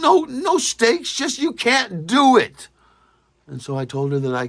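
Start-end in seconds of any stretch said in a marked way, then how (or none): 0.0-2.7 put-on slow, higher teasing voice of the girlfriend. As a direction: drawl out the dare playfully. Quoted bet with the girlfriend.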